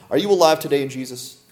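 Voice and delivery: usual speaking voice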